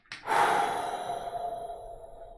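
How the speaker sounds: long breathy sigh of distress; shaky sigh. Real, not acted. Distressed by noise in head.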